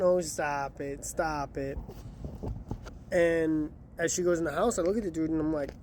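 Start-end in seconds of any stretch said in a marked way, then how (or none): 0.0-1.8 coy laughing brush-off. Retold or imitated. Lady brushing off the driver's joke, slow coy mock voice. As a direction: play the giggly neighbor waving off a joke. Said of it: "she starts laughing".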